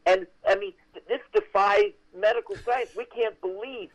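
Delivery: imitating amazed producers; retold amazement.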